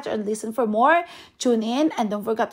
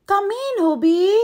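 higher sugary welcome, ending in a whisper; sweet principal; 9 semitones higher, 4 dB louder than usual. Acted. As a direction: lure him in sweetly. Principal greets the boy.

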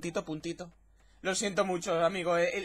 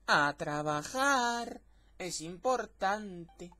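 very slow, stretched-out character line; drawn-out reading; much slower than usual. Acted. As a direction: drag each word right out. Voices game character, stretched words. (lectura muy lenta y alargada)

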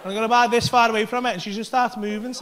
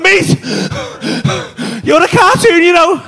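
high, loud astonished cry; loud laughing astonishment; amazed; 7 semitones higher, 11 dB louder, much slower than usual. Retold or imitated. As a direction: exclaim in wonder, laughing. Telling friend he's a cartoon.

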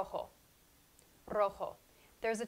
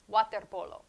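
ordinary talk, nothing marked

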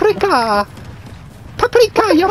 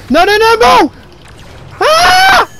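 loud, high desperate shout; panicked yelling; 8 semitones higher, 4 dB louder than usual. Real, not acted. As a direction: cry out as you're getting killed. Gamer about to die.

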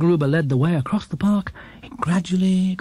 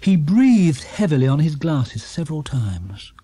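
ordinary delivery

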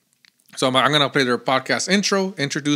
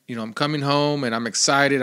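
normal talking voice

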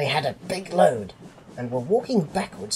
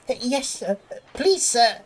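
high, timid little voice; nervous; 10 semitones higher than usual. A narrator's reading. Percy answering the cross fat controller, nervous. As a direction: plead meekly and nervously, pitched high. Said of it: "said Percy nervously".

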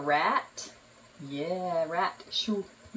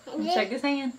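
ordinary delivery

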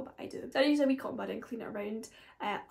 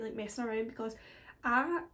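ordinary delivery

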